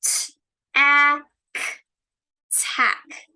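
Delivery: segment then blend; tapping out sounds. Shown as a demonstration. Teacher leading class tapping out tack, then blending. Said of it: "Everybody stand up".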